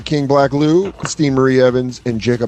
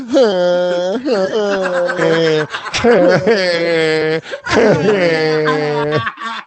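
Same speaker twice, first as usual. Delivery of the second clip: long, hammed-up bleating sob; mock crying; 4 semitones higher than usual. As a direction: wail it out in stretched fake sobs. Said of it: "That's how they all cry".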